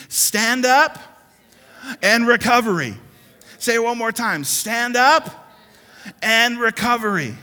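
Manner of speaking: loud, high sermon call for the church to repeat; rousing call-and-response preaching; full-force preaching. Acted. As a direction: lead the crowd, shouting it out.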